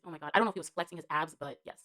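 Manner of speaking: ordinary talk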